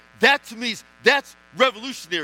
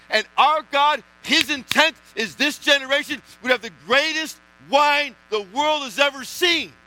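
louder, rising preacher's climax; preaching at full force; 4 semitones higher, 4 dB louder than usual. Acted. Pastor stirring the church about more wine, preaching at full force. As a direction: drive it home to the congregation.

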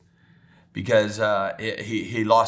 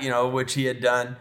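ordinary talk, nothing marked

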